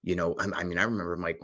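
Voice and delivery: ordinary talk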